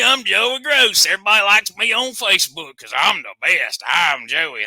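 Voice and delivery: strut in bragging like a vain wrestler; mock Joey Gross. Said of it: "so I could come as Joey".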